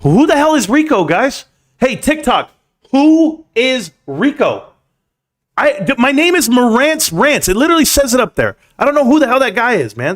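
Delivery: annoyed that a viewer called him Rico; exasperated. Real, not acted. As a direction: push back, baffled and annoyed. Annoyed at wrong name.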